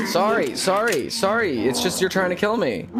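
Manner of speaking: high frantic apologies; terrified gibbering. Real, not acted. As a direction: plead high and scared. Apologizing to the monster.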